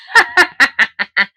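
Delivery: high, real burst of laughter; gleeful cackle. Real, not acted. Cackles at the card reading.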